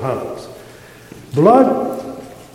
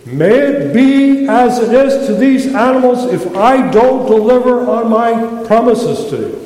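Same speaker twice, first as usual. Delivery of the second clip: solemn oath; 6 semitones higher, 4 dB louder than usual. Retold or imitated. God swearing a blood oath.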